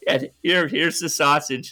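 mock sweet talk to the dog; coaxing a dog. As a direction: coo at the dog like feeding it. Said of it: "just like".

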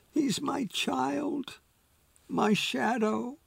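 slow, high, soft tearful plea; a mild hint of crying through the words. A narrator's reading. Pam grieving to Troy over her dog, slow and tearful. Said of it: "Pam couldn't stop crying".

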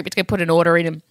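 usual speaking voice

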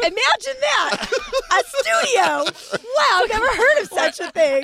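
slow, high, loud sarcastic wonder; hammed-up astonishment; mock amazed Jeff. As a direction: gush like it's never been heard of. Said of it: "Jeff's like".

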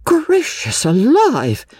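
high slow shocked exclamation; mother shocked at the bathers; shocked cry. A narrator's reading. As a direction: gasp it out in shock. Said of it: "cried Missus Honeychurch".

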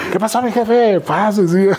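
high-pitched imitated greeting, pushing an offer; over-familiar stranger. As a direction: pitch it way up, chummy and insistent.

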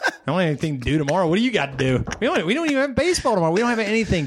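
rapid, rising protest as the son; mock kid protest. Son argues against dad's bedtime, mock protesting. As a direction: imitate a kid arguing his case, pitch climbing.

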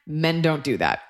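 ordinary talk, nothing marked

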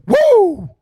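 usual speaking voice

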